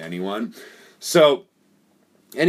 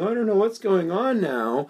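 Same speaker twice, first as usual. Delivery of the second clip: higher, drawn-out imitation of coy innocence; mock coy; 7 semitones higher, slower than usual. Retelling a troublemaker feigning innocence at a party, mock coy. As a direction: play coy, slowly and higher. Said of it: "she's sitting there all coy".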